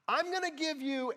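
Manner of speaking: high, loud threatening yell; imitating a mom's yell. Mom threatening her kid from forty feet, loud high yell. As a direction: bark a threat across the church like the mom. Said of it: "These were her exact words".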